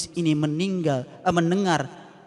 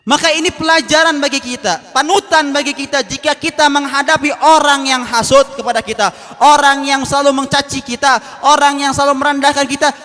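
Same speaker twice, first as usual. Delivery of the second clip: higher and louder, driving each line; fiery preaching; 8 semitones higher, 10 dB louder than usual. Real, not acted. Preacher exhorts the congregation, full force. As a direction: preach it out to the hall.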